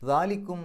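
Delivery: ദാലിക്കും ringing out, joined without stopping; recited straight through. Shown as a demonstration. Teacher showing continuous recitation, loudly. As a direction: call out ദാലിക്കും in a full reciting tone. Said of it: "അങ്ങനെ ഓതിപ്പോയാൽ മതി".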